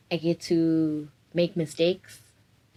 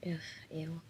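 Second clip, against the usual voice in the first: ordinary talk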